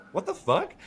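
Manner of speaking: baffled. Retold or imitated.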